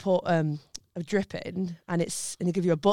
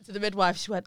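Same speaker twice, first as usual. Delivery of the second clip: normal talking voice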